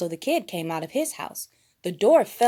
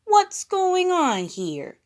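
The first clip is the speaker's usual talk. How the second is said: high, slow puzzled question; slow high voice, asking calmly; drawn-out high question; Kermit; 12 semitones higher, 6 dB louder, slower than usual. A narrator's reading. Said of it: "said Baby Kermit".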